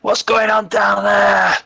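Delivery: loud, high, drawn-out holler; calling down. Acted. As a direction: shout it slowly to someone far below. Calling down the shaft in character.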